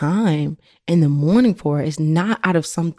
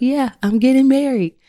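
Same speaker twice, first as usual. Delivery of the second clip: excited; 8 semitones higher than usual. Real, not acted. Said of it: "I'm so excited about that".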